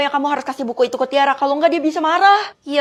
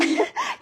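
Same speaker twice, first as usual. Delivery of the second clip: mocking cackle; 5 dB quieter than usual. Scornful laugh at onions.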